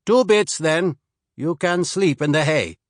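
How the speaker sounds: put-on wary Swede sizing up a stranger, slight accent; slight Swedish accent. Swede asks the traveler who sent him, slight Swedish accent. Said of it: "He spoke with only a slight accent".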